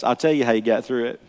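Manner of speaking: low, soft voice of divine reassurance; God. Acted. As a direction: drop low and quiet, letting the last words land after a pause. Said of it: "it was like this voice of God says".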